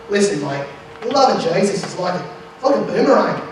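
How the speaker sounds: hammed-up Australian parable-teller; mock Australian accent. Comic missionary telling Australians a parable, mock Australian accent. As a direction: preach in a playful Australian accent. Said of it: "tell those Australian people".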